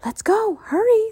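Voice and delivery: put-on a keen girl coaxing her brother; lively Annie. Annie urging.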